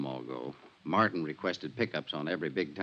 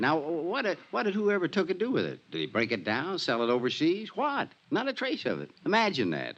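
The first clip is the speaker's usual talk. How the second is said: rising, disbelieving outburst; incredulous excitement; 8 semitones higher than usual. Acted. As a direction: pitch climbing up, worked up and amazed.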